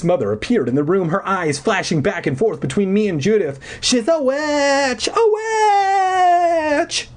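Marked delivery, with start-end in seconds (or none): 3.8-7.1 very high, drawn-out screech; screamed. A narrator's reading. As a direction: screech the accusation long. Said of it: "Judith screamed".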